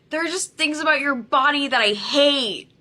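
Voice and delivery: loud, high imitation of his outburst. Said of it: "raises his voice and he goes".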